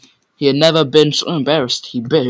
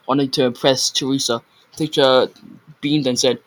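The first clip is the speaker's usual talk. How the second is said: ordinary delivery, nothing marked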